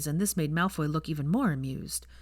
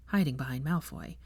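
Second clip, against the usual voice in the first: ordinary delivery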